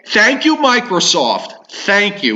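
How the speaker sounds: sarcastic thanks, drawn out. Real, not acted.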